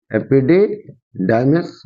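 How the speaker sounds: Epididymis said slowly in separated parts; teacher's slow, careful repeat of epididymis; slow dictation. Shown as a demonstration. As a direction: break up epididymis for students to catch. Teacher repeats term for students.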